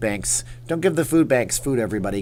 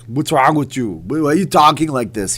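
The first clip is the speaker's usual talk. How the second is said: loud Austrian action-star impression; hammed-up Austrian accent; Arnold Schwarzenegger; 3 semitones higher, 6 dB louder than usual. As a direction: Austrian strongman demanding an answer. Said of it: "I'd do it all as Arnold".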